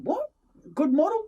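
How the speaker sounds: high, loud disbelief; startled double-check; stunned question about a model gun; surprised. Retold or imitated. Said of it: "I said".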